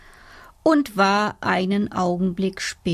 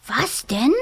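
curious dormouse voice; 3 semitones higher than usual. Acted. Dormouse pricks up ears.

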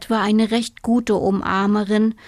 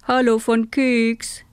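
bubbly fish hello; goldfish voice. Acted. Goldfish greets the toad, lightly voiced.

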